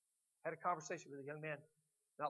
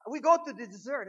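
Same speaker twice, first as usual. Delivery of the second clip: high, loud mock German accent; booming imitation of a German priest's accent; 8 semitones higher, 13 dB louder than usual. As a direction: proclaim it in a put-on German accent. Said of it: "that's how he said it".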